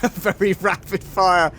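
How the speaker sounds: bubbling over with glee; excited. Real, not acted. Delighted about the laser.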